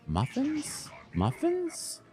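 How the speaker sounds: muffins? jumping way up in pitch; a squeaky, disbelieving question; baffled surprise. Real, not acted. Baffled by a game item.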